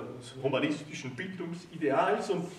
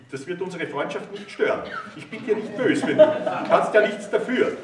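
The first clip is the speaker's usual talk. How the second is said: laughing; 6 semitones higher, 7 dB louder than usual. A narrator's reading. Jealous husband laughing it off.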